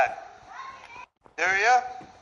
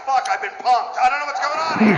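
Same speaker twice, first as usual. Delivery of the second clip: shocked woman; 7 semitones higher, 3 dB louder than usual. Acted. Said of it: "She gets this look on her face like".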